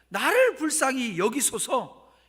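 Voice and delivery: loud, drawn-out plea for pity; crying out. Acted. Blind Bartimaeus calls to Jesus for mercy, crying out. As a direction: call out at full voice, slowly, begging to be heard. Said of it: "그냥 자기 목소리로 소리 지르는 겁니다".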